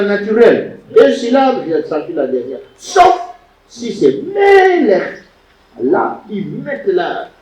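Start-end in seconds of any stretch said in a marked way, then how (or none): 4.2-5.2 "Melech" with ME stretched high and loud; MEEE-lech, slow, raised first syllable in "Melech"; stress on first syllable. Shown as a demonstration. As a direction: lean hard and long on the ME of "Melech". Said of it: "l'accent visible pour que tout le monde".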